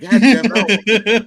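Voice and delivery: loud, drawn-out slow groan; hammed-up outrage at being woken; stretched-out mock complaint; mock groggy groan.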